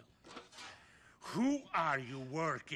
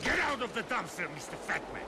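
thug's bark; 8 semitones higher, 4 dB louder than usual. Acted. Thug orders him out.

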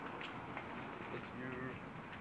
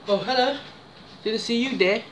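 mock surprise; 9 semitones higher than usual. Pretends to notice the package.

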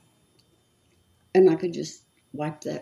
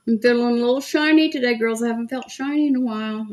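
playful sing-song; 7 semitones higher, 3 dB louder, faster than usual. Pretend. Playful shiny remark to viewers.

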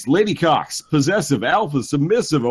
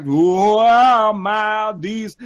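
slow, high, theatrical recital; dramatic list reading; 4 semitones higher, slower than usual. Acted. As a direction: declaim each kink like a show. Reading kink list over music.

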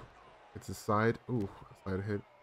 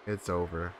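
usual speaking voice

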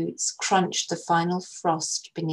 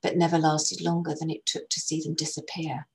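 usual speaking voice

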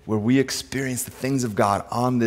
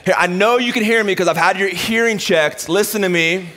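higher-pitched mock lecture to kids; exasperated parent; 6 semitones higher than usual. Dad telling kids he had their hearing checked, exasperated. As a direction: play a dad insisting on being heard. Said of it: "if you talk loud and slow".

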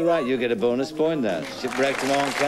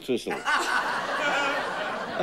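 laughs through the words, quick; bubbling over with laughter, fast; laughing; 12 semitones higher, 3 dB quieter, much slower than usual. Real, not acted. Host amused by the guessing, laughing while he talks.

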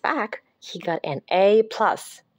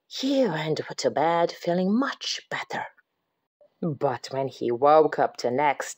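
whisper; 6 semitones lower than usual. A narrator's reading. Whispered narration.

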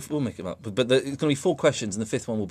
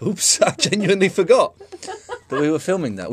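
laughing; 9 semitones higher, 6 dB louder than usual. Real, not acted. Laughs at own blunder.

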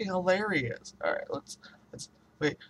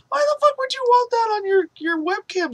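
shrieking laugh-yell; 6 dB louder than usual. Real, not acted. Freaked out by face-tracking webcam.

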